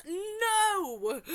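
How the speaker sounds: high, loud crying no; shrieked no. Acted. Mom shrieking no at the baby teether question. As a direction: wail it in horror.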